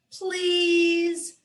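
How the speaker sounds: high, drawn-out please; pleading little girl. Acted. Little girl begging her father to teach her. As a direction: beg sweetly like a child. Lola begs Poppy.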